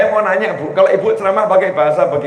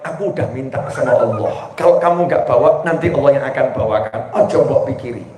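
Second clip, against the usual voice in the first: calm reassurance; 8 semitones lower than usual. Acted. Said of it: "Istrinya menjawab".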